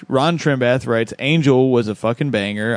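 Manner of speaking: ordinary talk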